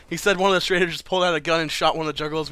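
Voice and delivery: usual speaking voice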